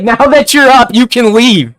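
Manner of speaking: high, loud, curt brush-off; rude and angry. Retold or imitated. Ichigo tells the boy who just woke up to leave, loud and rude. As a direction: throw him out, clearly annoyed. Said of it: "you can see he's angry here".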